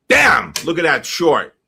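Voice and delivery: a loud, high burst of excitement; excited shout. Real, not acted. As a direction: shout it out at the sudden drop. Sudden market drop, real outburst.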